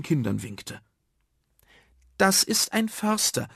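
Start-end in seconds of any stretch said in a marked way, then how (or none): 2.2-3.5 put-on high-pitched child explaining; explaining boy. Karl tells the class who the man is, explaining. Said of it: "erklärte Karl".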